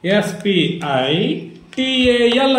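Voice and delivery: ఎస్ పి ఐ joined, then టి ఏ ఎల్ joined; slowly chunk ఎస్ పి ఐ / టి ఏ ఎల్; grouped spelling. Shown as a demonstration. Teacher shows pupil how to spell in groups, letters run together. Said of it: "ఒక్కొక్క అక్షరం చెప్పకుండా".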